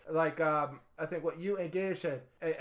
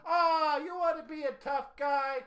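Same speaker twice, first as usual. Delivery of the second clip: mock tough-guy taunt; 9 semitones higher than usual. Acting out taunting the wrestler.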